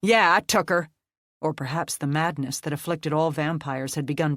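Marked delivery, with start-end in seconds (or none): 0.0-0.9 defiant taunt. Acted.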